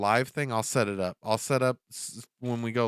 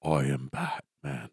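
Batman voice; 9 semitones lower, slower than usual. Pretend. Batman impression, low.

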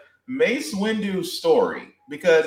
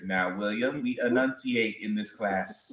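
lower, quieter strict fourth grade teacher; prim teacher voice; 8 semitones lower, 7 dB quieter than usual. Pretend. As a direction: talk down like a fussy English teacher scolding a pupil. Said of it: "fourth grade English teacher".

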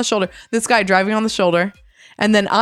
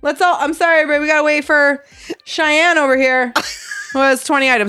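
sarcastic mock apology; 6 semitones higher than usual. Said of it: "I would just tell everybody in line".

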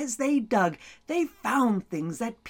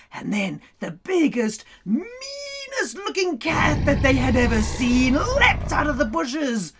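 usual speaking voice

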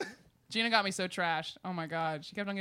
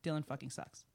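blunt deadpan; 6 semitones lower, 9 dB quieter than usual. Retold or imitated. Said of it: "kept on repeating it".

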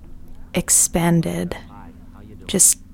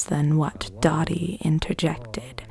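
usual speaking voice

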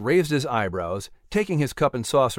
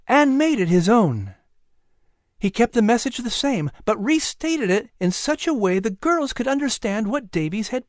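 high woman's voice, words heavily stressed; exaggerated emphasis; 9 semitones higher than usual. A narrator's reading. Sarah recounts the boy's retelling of the sermon, exaggerating for emphasis. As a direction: recount it proudly, leaning on each word. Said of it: "exaggerating each word for emphasis".

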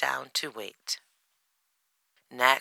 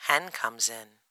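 ordinary delivery

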